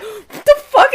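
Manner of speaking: high, loud shriek with a laugh; loud, laughing scream of alarm; shocked shriek. Retold or imitated. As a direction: shriek in panicked disbelief. Learns the snake is real.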